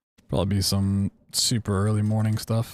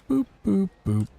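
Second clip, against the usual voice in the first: robot beeping; 11 semitones higher than usual. Pretend. Playful robot beeping noises.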